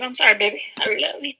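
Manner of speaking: put-on soft, falling sweet talk to baby; mock tender apology. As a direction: coo it softly to the husband.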